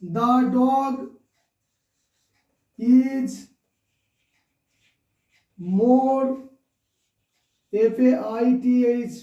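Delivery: word by word, long silences between; dictating while writing. Real, not acted. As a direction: spell it out piece by piece as you write. (লিখতে লিখতে থেমে থেমে বলা)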